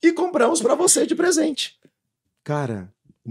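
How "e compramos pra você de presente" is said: high, lively imitation of the hosts' thank-you speech; slightly raised, loud, cheery host voice; mock gushing thanks. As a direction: play the sweet emcee thanking the preacher. Organizers thank him on stage.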